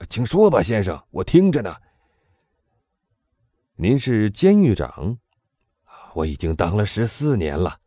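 two men questioning over records; voiced dialogue. A narrator's reading. Englishman questioning the warden about a prisoner, lightly voiced.

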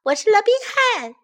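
high, quick, loud boast; playful shout. A narrator's reading. Baby bear playing hero, high quick shout. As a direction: proclaim it like a proud cub. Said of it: "he shouted".

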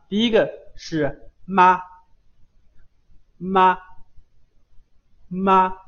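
妈 said as ma, lips pressed shut then opened into a; crisp, emphatic 妈 with closed lips popping open to a; model syllable ma. Shown as a demonstration. Teacher modelling 妈 for students, crisp and emphatic. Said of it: "刚开始双唇紧闭".

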